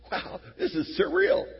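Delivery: awed disciple. Acted. Disciple at breakfast with risen Jesus, awed surprise.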